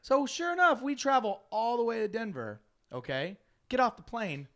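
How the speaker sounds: usual speaking voice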